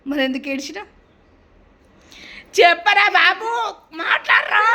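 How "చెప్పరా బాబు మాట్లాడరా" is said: high, loud urging; exasperated shout. Real, not acted. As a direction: shout at them to speak up. Exasperated demand that boy speak.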